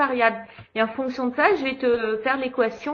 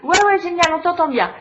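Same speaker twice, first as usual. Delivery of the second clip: excited; 6 semitones higher, 8 dB louder than usual. Real, not acted. Relief caller is heard.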